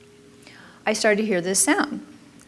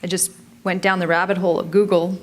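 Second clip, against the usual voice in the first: ordinary talk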